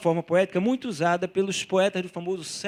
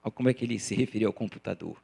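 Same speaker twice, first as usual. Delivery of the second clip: ordinary talk, nothing marked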